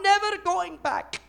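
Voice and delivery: very high, hammed-up declaration; put-on foreign speaker. Acted outburst after speaking Somali.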